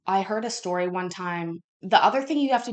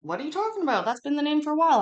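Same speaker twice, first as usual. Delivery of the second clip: baffled; 8 semitones higher, 4 dB louder, faster than usual. Retold or imitated. Retold baffled reply about name.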